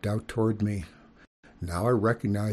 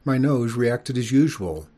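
ordinary delivery, nothing marked

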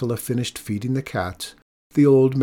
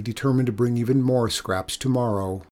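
usual speaking voice

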